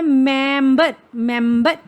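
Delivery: मेंबर sounded out, opening firmly on "m"; drawn-out मेंबर with a clear first "m"; initial M shown. Shown as a demonstration. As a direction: say मेंबर deliberately, starting with the "m" sound. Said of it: "कैसी sound आ रही है".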